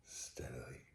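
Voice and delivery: stealthy whisper. A narrator's reading. Madman easing the door open in darkness, whispering. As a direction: whisper it, creeping in.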